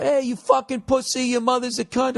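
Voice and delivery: put-on high, jeering taunt from a bar drunk; mock drunk insult. Drunk stranger taunting a man in public, jeering. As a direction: sneer it out like a loudmouth picking a fight.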